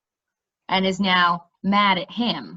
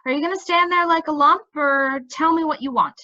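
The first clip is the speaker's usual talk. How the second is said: exasperated; 6 semitones higher than usual. A narrator's reading. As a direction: hurry him up, fed up with him. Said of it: "she said in an exasperated tone".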